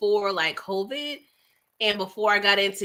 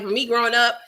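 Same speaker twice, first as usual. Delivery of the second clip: normal talking voice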